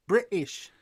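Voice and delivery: "British" broken by a catch for the T. Shown as a demonstration. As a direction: demonstrate "British" with no T. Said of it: "say British without the T".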